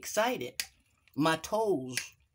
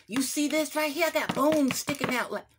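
excited; 10 semitones higher, 3 dB louder than usual. Acted. Showing off the bones.

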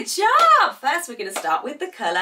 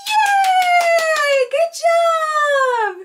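very high, drawn-out cheer; long high-pitched celebration; excited cheer; 11 semitones higher, 4 dB louder, much slower than usual. Real, not acted. Cheers the child on.

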